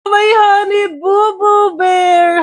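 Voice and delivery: high, slow, loud sweet-talk call; gushing shout. Acted. Lovesick partner calling out to babe, drawn-out gushing shout. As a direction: stretch every word out like a lovesick partner.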